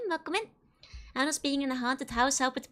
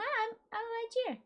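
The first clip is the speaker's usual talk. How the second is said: much higher, tiny mock voice popping in; squeaky put-on voice; 10 semitones higher, 3 dB quieter than usual. Answering chat that misses her, high squeaky voice. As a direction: pretend to be someone small answering. Chat says they miss Joanna.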